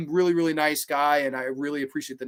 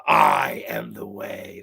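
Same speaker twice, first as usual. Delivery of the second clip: ominous Pinhead voice; 9 semitones lower, much slower than usual. Acted. Reading Pinhead's line from shirt.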